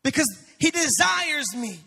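fervent pulpit declaration; preaching. Acted. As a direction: lay it down word by word. Preaching full force.

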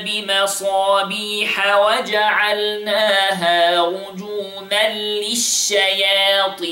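measured tajweed recitation with long vowels; Quran recitation. Acted. Teacher reciting the verse for students to copy. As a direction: recite it like the teacher's model reading.

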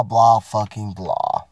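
usual speaking voice

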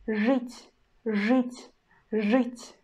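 жить said slowly with hard ж and ы; Russian demo word. Shown as a demonstration. Teacher demonstrates жить to students, careful hard ж. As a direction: model the word жить for learners, hard ж then ы. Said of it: "So here we got an example".

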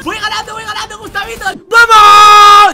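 loud triumphant gamer yell; excited shouting. Real, not acted. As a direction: shout with victory glee. Winning the round.

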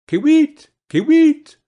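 high, loud, piping bird cry; bird call. A narrator's reading. Bird singing from the juniper tree, high, loud call. Bird's call within its song.

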